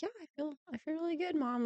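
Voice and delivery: put-on high, soft child's voice; imitating her son. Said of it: "and he's like".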